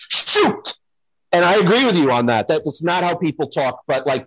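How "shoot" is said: high, breathy mock "shoot". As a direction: squeak "shoot" like a sitcom actor. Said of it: "just like you just did with".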